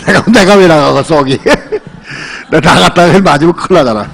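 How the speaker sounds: laughing while joking about going out; chuckling through the words. Real, not acted. Pastor laughing off the idea of facing the axe, laughing.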